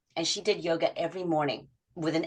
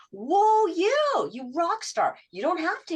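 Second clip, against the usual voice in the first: much higher and louder, a delighted whoa; excited praise; 11 semitones higher, 4 dB louder, slower than usual. Retold or imitated. Coach cheering a client who did an extra workout, excited praise. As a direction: cheer her on like a proud coach.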